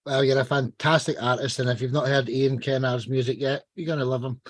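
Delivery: ordinary talk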